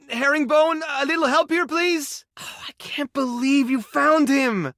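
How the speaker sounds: high-pitched, eager appeal; excited call. Acted. Asking Herringbone for help, they found him, excited. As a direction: call for a hand, voice lifted with amazement.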